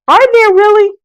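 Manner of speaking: high, loud, thrilled; delighted are-you-serious; excited disbelief. Real, not acted. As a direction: squeal at the news. Excited about magazine news.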